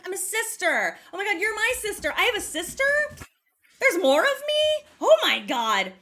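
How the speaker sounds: high, amazed re-enacted outburst; so excited. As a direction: freak out with joy over the news. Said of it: "I'm so excited 'cause I'm like".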